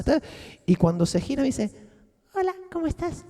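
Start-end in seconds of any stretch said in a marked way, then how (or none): 2.3-3.2 lightly voiced little girl, higher; a light hint of a small child greeting. Girl turned childlike greets him, lightly higher little girl voice. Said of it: "se convirtió en una niña pequeña".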